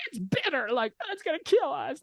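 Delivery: high, squeezed whining voice; hammed-up griping of an upset crowd; whiny mock complaint. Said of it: "They're all pissed".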